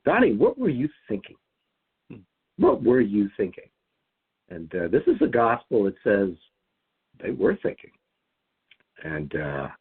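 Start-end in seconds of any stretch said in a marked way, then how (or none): 0.0-3.7 lightly voiced scolding parent, a bit higher; a hint of an exasperated parent scolding a child. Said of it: "I can hear my parents' voice going".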